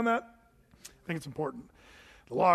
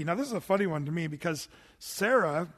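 ordinary talk, nothing marked

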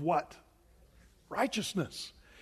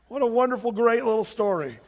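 sarcastic praise; 5 semitones higher, 6 dB louder than usual. Real, not acted. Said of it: "ironic remark".

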